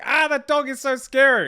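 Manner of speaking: high, loud hammed-up alarm; mock scared onlooker. Quoting people at the dog park, hammed-up fearful cry. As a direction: play a panicky passer-by. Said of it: "Everyone's like".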